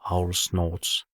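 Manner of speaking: usual speaking voice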